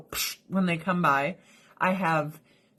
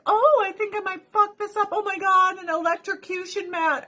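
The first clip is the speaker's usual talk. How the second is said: much higher, mock-worried cat; 11 semitones higher than usual. Owner voicing her cat spotting the mat, high and mock. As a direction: squeak it like a scheming cat. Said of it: "Then she's like".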